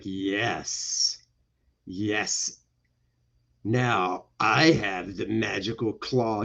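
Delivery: low, soft, slow villain voice; gloating villain. Acted. Villain gloats to the chained heroine, sinister. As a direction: play a sinister villain, savoring it.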